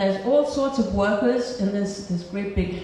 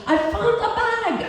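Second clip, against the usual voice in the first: high-pitched, jubilant holler; triumphant holler. Retold or imitated. Storyteller hollers news of the found bag to cheering workers, triumphant holler. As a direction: holler it out high, announcing a great find. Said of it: "I let out this holler and say".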